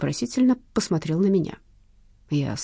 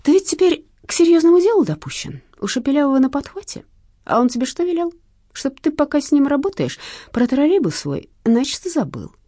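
raised, louder, knowing policeman; lightly voiced probing interrogator, higher; needling cop; 9 semitones higher, 6 dB louder than usual. A narrator's reading. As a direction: show the thief you know everything.

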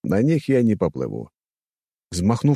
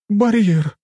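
high, loud female cry of delight; overjoyed; 14 semitones higher, 5 dB louder than usual. A narrator's reading. Woman discovers her barrier is back, overjoyed. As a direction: exclaim with joy in a raised voice. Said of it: "обрадовалась какая-то женщина".

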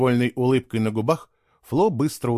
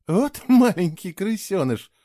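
half angry, half laughing; 8 semitones higher, slower than usual. A narrator's reading. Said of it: "то ли со злостью, то ли со смехом".

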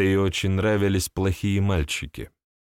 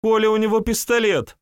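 high panicked shriek; frightened squeal; 13 semitones higher, 4 dB louder than usual. Acted. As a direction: squeal it out in terror. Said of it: "испуганно взвизгнула".

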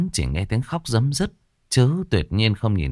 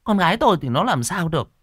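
high, scolding voice of a worried wife; reproachful wife; 8 semitones higher than usual. A narrator's reading. Wife dismissing husband's worry about their daughter, reproachful. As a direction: chide him in a raised pitch. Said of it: "trách chồng".

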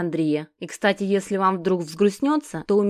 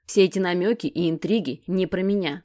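ordinary delivery

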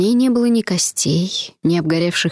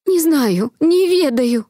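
shrill maid; 9 semitones higher, slower than usual. Acted. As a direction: squeal it out in panic. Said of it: "визгливо отозвалась служанка".

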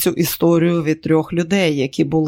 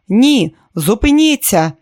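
louder, higher cry to stop, drawn out; narrated cry out; 6 semitones higher, 6 dB louder, slower than usual. A narrator's reading. Woman crying out to stop a man, raised voice. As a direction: call out slowly in raised alarm. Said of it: "вона закричала".

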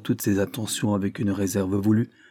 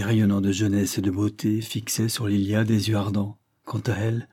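plain reading voice

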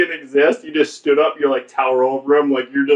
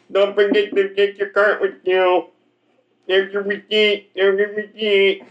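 higher, slurred mock greeter voice; slow greeter; 4 semitones higher than usual. Comic playing greeter handing out receipts, slow-witted mumble. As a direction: imitate a simple, eager store greeter. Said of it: "they talk like that".

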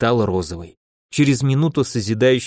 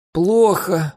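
pained, pitched-up answer; wincing in pain; 7 semitones higher than usual. A narrator's reading. Wounded seer tells her friends she feels bad, wincing. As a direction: push it out through the pain. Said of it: "морщится от боли видящая".

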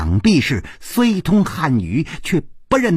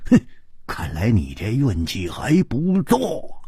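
low, softer, amused remark; amused teasing; 6 semitones lower, 3 dB quieter than usual. A narrator's reading. Hu Tiehua teases the man about his luck, amused. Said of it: "笑着说".

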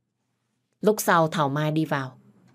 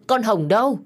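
higher, louder repeat of the call; louder shout; 5 semitones higher, 4 dB louder than usual. A narrator's reading. Phương shouts again for Hồng, higher and louder. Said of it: "vừa đi vừa hét".

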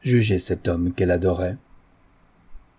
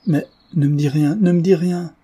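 high, rising plea; urgent pleading; 8 semitones higher, 4 dB louder than usual. A narrator's reading. Daughter begging her father to say nothing, urgent. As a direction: beg for silence, pitch climbing.

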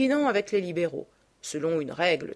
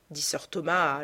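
usual speaking voice

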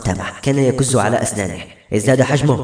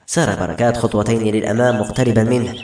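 ordinary delivery

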